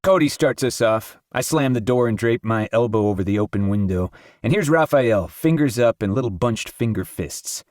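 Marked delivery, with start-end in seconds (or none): none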